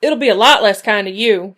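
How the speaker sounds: loud growling threat; growled. A narrator's reading. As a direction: growl it back at him. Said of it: "she growled".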